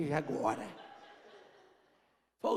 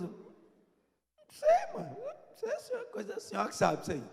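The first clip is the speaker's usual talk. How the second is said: very high falsetto, innocent shrug; playing dumb; 14 semitones higher than usual. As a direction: squeak it out like a kid dodging the question. Son plays dumb, fingia de bobo. (voz de falsete fingindo inocência)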